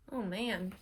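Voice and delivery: ordinary delivery, nothing marked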